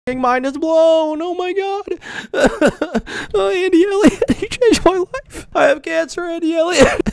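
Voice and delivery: mock gushing fan. Mocking a fan thanking the guru, falsetto gush. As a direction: gush like a starstruck devotee.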